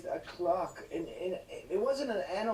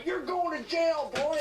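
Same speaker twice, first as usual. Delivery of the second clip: high, loud sneering cop; 10 semitones higher, 5 dB louder than usual. Acted. Cops cuffing the boy, loud mock sneer. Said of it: "They go".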